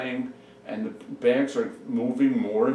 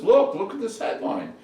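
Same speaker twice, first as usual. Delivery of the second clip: high, louder call to look; excited urging; 10 semitones higher, 5 dB louder than usual. Acted. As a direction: urge him to look, pitched up and eager. Doctor urgently shows headline.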